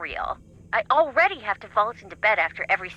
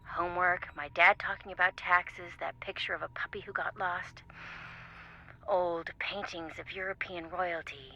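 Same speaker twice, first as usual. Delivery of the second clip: quiet, solemn and slowing down; mock-serious, hushed listing; 6 dB quieter than usual. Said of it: "Can you channel serious [name] for a moment?".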